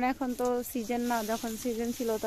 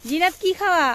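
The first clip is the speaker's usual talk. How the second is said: calling out; 6 semitones higher, 8 dB louder than usual. Real, not acted. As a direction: call her name across the field. Calling out to a child.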